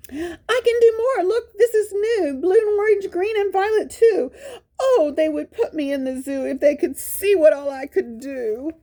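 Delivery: high, teary eager voice; the dog wishing the zoo could see his tricks; tearful Spot. Acted. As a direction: plead your talent, almost crying. Spot boasting, near tears.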